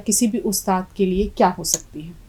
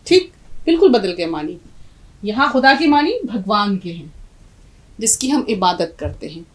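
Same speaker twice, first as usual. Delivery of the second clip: ordinary talk, nothing marked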